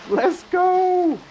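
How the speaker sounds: high, loud excited cheer; excited shout. Real, not acted. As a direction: yell it as you launch. Zip line launch.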